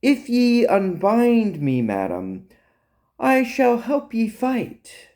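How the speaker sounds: high, slow fake British accent; theatrical fake British with swooping pitch. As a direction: put on a mock British accent, drawn out. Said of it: "with a fake British accent".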